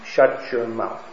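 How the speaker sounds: curt, mildly harsh; lightly acted rude shush; harsh rebuke. Shown as a demonstration. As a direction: snap it out, a mild demo. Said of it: "a harsh way would be".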